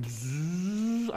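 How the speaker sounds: slow, soft buzz; a lightly mimicked whoosh; buzzing flying noise. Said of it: "flying noise he made".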